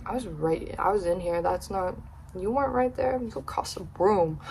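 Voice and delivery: under your breath, skeptical; quiet sceptical mutter; muttered to self. Retold or imitated. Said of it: "I was like".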